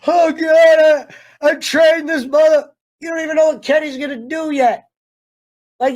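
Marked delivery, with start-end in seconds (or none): 0.1-2.7 high, loud hammed-up wail; mock tearful. Mocking Robbie's tearful regret over Kenny, hammed-up wail. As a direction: parody a melodramatic crying fit. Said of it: "weird-ass tears where he's just like".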